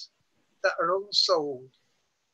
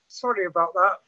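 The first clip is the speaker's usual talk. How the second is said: usual speaking voice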